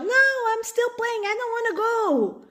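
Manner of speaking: put-on high, whining little kid; child at pickup refusing to go home, high and whiny. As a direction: whine like a kid who won't leave the playground. Kid refusing to leave kindergarten.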